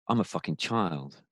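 dropped low and soft, a stunned admission to himself; quiet realization. Retold or imitated. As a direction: say it quietly, as if it just hit you. Sudden self-realization, retold inner voice.

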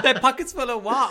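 high, loud laughing question; giggling as she asks what the lyric was. Real, not acted. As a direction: laugh through the words in disbelief.

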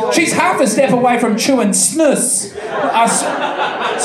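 normal talking voice

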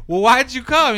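high, loud incredulous retort; incredulous question. Retold or imitated. Narrator retorting to Jack, high loud disbelief. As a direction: fire it back in disbelief. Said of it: "And I was like".

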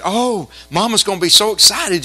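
imitated excitement. Daughter thinking of Mama's gift, imitated excitement.

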